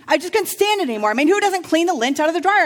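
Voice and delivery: fast, high, loud indignant rant; mock outrage. Divorced partier complaining about his ex-wife, fast mock-indignant rant. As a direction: play up his grievance over the lint.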